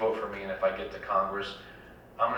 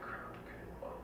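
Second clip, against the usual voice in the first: whispered dismay; 14 dB quieter than usual. Retold or imitated. Retold reaction to bad news.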